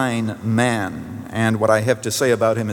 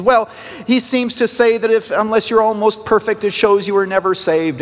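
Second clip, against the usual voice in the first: higher-pitched retelling of a troubled caller; hint at an upset elderly woman, pitched up; lightly voiced distressed woman; 9 semitones higher than usual. Woman explains her doubt to her pastor, lightly imitated distress.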